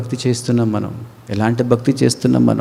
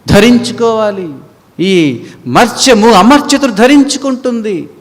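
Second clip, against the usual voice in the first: higher, louder slow proclamation; preached scripture; 10 semitones higher, 6 dB louder than usual. Acted. Preacher proclaiming Corinthians verse to the church, loud preaching. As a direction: ring out the verse.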